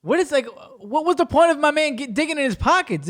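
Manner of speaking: high-pitched incredulous protest; baffled outburst. Retold or imitated. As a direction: act out total disbelief at a pointless trick. Acts out baffled reaction to magician.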